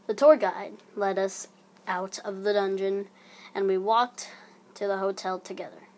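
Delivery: ordinary delivery, nothing marked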